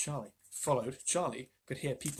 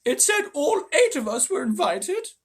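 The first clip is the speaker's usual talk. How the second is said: higher, louder, slow; old woman insisting on the invitation; cried; 13 semitones higher, 8 dB louder, slower than usual. A narrator's reading. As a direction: cry it out insistently. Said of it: "cried Grandma Josephine".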